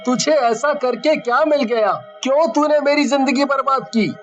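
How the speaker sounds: very high-pitched, rushed accusation; anguished anger. Acted. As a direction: demand why, high and distraught. Victim confronts his tormentor. (दर्द और गुस्से भरी ऊँची आवाज़)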